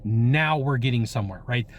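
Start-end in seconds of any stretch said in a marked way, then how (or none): none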